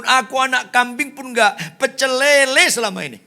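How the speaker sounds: high, loud aggrieved outburst; angry complaint. Acted. Elder son protesting to his father, angry. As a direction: pour out years of grievance at full voice.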